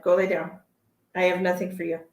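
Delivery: ordinary delivery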